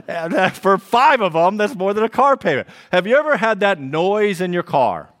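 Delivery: worked up comic complaint; exasperated rant. Real, not acted. Preacher ranting about car repairs, loud and laughing.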